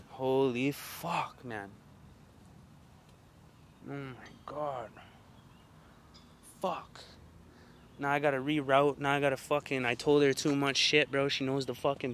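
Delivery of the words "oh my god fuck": usual speaking voice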